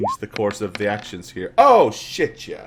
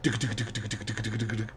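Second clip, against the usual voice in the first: quick low, soft patter noise; imitated footsteps; 3 semitones lower, 10 dB quieter, faster than usual. Game master imitating a child's approaching footsteps, softly. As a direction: make little running-feet thuds quietly.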